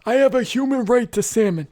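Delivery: high, loud whiny Larry David; Larry David impression. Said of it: "Excellent Larry David impersonation".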